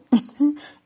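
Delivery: a high, loud burst of real laughter. Real, not acted. Host reading viewer comments, bursts into loud laughter. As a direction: crack up at the comment.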